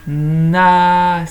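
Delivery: "Nas" loud, each sound held: nnn-aaa-sss; "Nas" slowly stretched from n to s; stretched syllable. Shown as a demonstration. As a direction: draw out all three sounds of "Nas". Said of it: "Qu'est-ce qu'on entend alors?".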